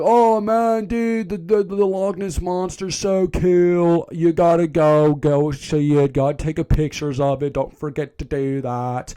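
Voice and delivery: hammed-up stoner hippie, starting high; imitated hippie. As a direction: gush like an excited cartoon hippie. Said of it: "a hippie".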